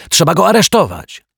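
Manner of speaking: high, loud urgent yell; boy yelling. A narrator's reading. Boy urging the detective to arrest the culprit, loud high yell. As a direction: demand the arrest at the top of his voice. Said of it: "wrzasnął chłopiec".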